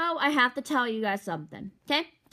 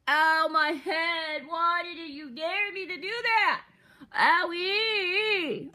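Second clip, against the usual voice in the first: mock crying; 4 semitones higher, 5 dB louder, slower than usual.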